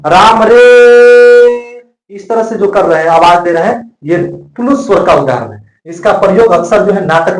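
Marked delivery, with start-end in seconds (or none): 0.1-1.8 राम रे stretched very slow, higher and louder, as if hailing someone far away; long calling out. Shown as a demonstration. Teacher showing how to call a distant person, long loud hail. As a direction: call राम रे across a distance, holding the vowel. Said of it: "जैसे किसी दूर स्थित व्यक्ति को बुला रहा है".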